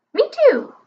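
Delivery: high squawking bird voice; parrot squawk. A narrator's reading. Parrot mocking the wizard, high squawk.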